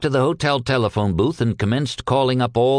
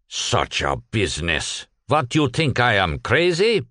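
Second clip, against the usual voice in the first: lightly voiced Yiddish shopkeeper. Cohn on phone, Yiddish accent.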